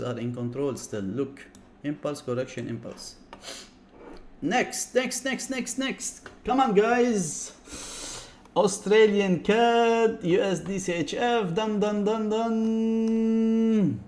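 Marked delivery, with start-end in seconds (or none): 4.4-6.3 rapid, high repeated calls; high-pitched urging. Real, not acted. Streamer hurrying viewers through their requests, high-pitched urging. 6.4-14.0 high, stretched-out mock exasperation; high drawn-out calling. Real, not acted. Streamer telling viewers these assets are done, high drawn-out calling.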